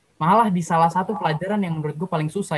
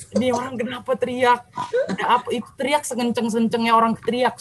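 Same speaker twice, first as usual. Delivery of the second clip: bewildered grandparent; 6 semitones higher than usual. Retold or imitated. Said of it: "opung gue tuh sampai bingung".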